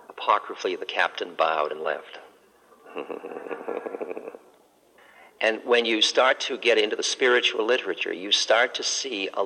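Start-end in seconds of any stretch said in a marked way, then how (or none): 2.9-4.4 a breathy, quiet chuckle; soft laugh. Real, not acted. Laugh after the story.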